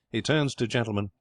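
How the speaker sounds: ordinary delivery, nothing marked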